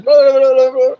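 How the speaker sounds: loud, high alien babble; alien gibberish. Acted. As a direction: rattle off high nonsense syllables loudly. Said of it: "he says".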